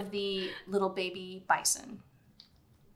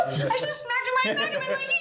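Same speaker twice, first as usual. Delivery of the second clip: usual speaking voice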